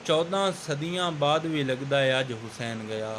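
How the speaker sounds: emphatic, measured poetry recital; verse recitation. Acted. Host introducing the poet with a verse, recited emphatically. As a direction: declaim the couplet with weighted pauses.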